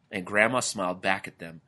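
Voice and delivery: usual speaking voice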